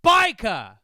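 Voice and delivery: full-blast, high British holler; explosive falling shout, dropped R. Retold or imitated. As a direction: bellow it as an exasperated old Brit. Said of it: "now even louder".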